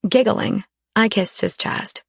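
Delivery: ordinary delivery, nothing marked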